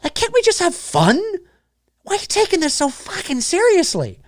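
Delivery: high whiny put-on voice of an online critic; mocking whiny critic. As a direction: play a whiny fan pleading to just have fun. Voicing a critic defending Mike.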